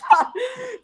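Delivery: loud, high burst of real laughter. Real, not acted. Laughing at her angry KFC days, a loud high laugh.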